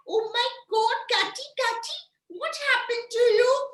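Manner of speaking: high-pitched fussing over a pet; high, sing-song pretend alarm; play-acted vet concern. Vet fussing over sick cat.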